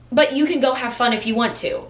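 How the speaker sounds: usual speaking voice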